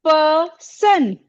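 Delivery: "पर्सन" slowly, as पर्... सन; syllable by syllable. Shown as a demonstration. Teacher shows pupils where to pause, "पर्सन" slowly in two syllables. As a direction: stretch "पर्सन" into two separated beats. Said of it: "इसको हम कैसे बोलते हैं".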